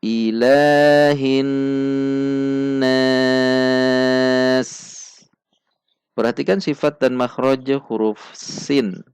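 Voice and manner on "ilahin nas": Ilahin nas drawn out slowly; Quran recitation. Shown as a demonstration. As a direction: chant Ilahin nas with long vowels. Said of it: "Kita baca sekali lagi".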